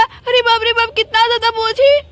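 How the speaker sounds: normal talking voice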